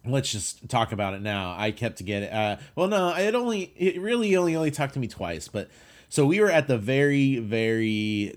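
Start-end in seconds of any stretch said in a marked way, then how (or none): 2.8-8.4 excited. Real, not acted. Excited telling.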